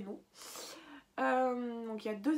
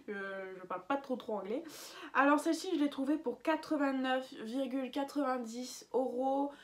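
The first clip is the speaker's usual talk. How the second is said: ordinary talk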